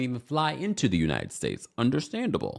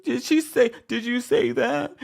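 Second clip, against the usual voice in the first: much higher and louder, hammed-up weepy question; mock fake crying Heather; 14 semitones higher, 4 dB louder than usual. Mocking Heather pretending to cry at Brittany, hammed-up. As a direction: parody a forced tearful gasp. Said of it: "Heather's, like, her fake crying".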